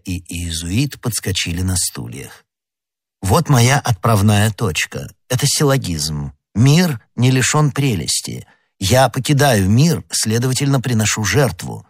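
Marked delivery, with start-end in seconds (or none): none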